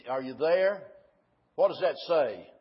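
ordinary talk

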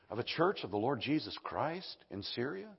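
usual speaking voice

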